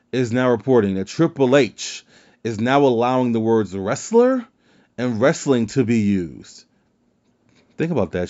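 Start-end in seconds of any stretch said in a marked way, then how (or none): 3.8-4.5 "wrestler" shot up much higher in disbelief; "wrestler" said very high, as if it's absurd; incredulous emphasis. Real, not acted. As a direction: leap up in pitch on "wrestler", amazed. Incredulous at allowed word.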